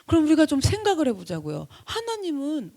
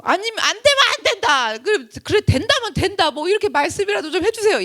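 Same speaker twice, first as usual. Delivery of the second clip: raised, urgent plea; pleading with God; 6 semitones higher, 5 dB louder than usual. Acted. Jesus begging the silent Father, urgent raised plea. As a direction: beg for any answer at all.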